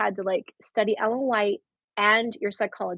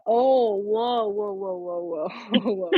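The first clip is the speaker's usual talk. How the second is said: mock awe; 2 semitones higher, slower than usual. Retold mind-blown reaction to professor.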